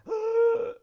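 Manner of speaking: sudden high, loud gasp of shock; sharp gasp. Acted. Bob gasping during his heart attack, loud high gasp.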